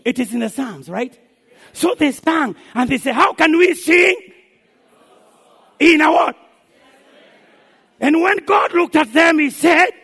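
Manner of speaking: loud, high, insistent preaching; full-force preaching. Acted. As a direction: drive the point home from the pulpit.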